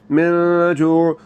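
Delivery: very slow من جوع, noon held in the nose; ghunna on noon. Shown as a demonstration. Teacher shows students ghunna in من جوع, slow and nasal. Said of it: "نون کی آواز کو ناک میں لے جانا ہے".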